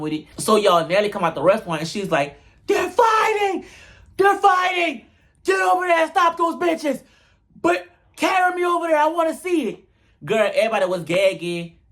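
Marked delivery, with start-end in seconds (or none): 2.7-9.8 excited shouting. Retold or imitated. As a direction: shriek it like you just spotted a fight. Nelly runs out yelling about fight.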